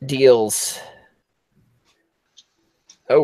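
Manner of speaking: usual speaking voice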